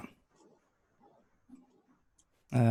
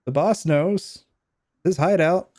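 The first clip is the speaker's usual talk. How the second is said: higher, slow, nervous henchman; captured henchman voice; 6 semitones higher, slower than usual. Acted. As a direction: play the scared hired thug giving up the boss.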